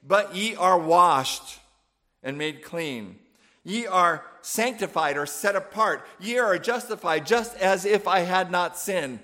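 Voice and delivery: each "are" stressed hard and slow; emphatic reading. Shown as a demonstration. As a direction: lean on every "are". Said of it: "emphatically saying".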